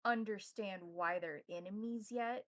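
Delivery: ordinary talk, nothing marked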